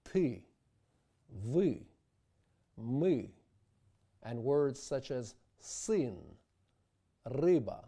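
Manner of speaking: ty, vy, my, syn, ryba with a back ы vowel; Russian ы words said clearly; Russian yery sound. Shown as a demonstration. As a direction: say each word with a pure Russian ы. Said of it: "which has no exact English counterpart".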